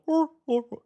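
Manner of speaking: high mock hor hor laugh; hammed-up cartoon bear chuckle; Freddy laugh. Mocking the voice actor's Freddy laugh, high mock chuckle. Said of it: "Not that hard to go".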